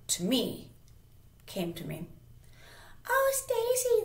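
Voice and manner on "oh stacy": very high, squeaky mocking imitation; exaggerated high-pitched girly greeting; mean girl's voice. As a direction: mimic her in a fake-sweet falsetto. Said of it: "that's how she talked".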